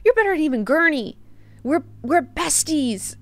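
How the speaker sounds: high-pitched put-on buddy voice; mock-affectionate, high character voice; Paul voice. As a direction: pitch it way up, playing the best friend. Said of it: "hits him with the Paul voice".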